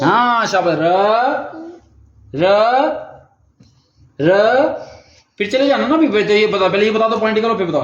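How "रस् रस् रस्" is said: र held long as "raaa", higher, with a pause after each; drawn-out syllable. Shown as a demonstration. Father prompting his child to repeat, high drawn-out syllable. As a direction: stretch each र upward and wait for an echo.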